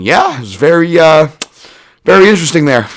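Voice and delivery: playing it off.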